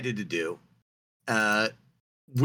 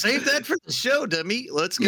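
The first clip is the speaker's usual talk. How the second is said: high mock scolding; 13 semitones higher than usual. Quoting himself to friend.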